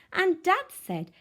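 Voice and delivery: ordinary delivery, nothing marked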